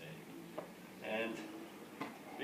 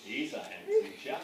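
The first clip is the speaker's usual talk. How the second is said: swooning inner voice. Retold or imitated. Father recalls first meeting the groom, high mock swoon. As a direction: gush it like a smitten fan. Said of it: "I thought".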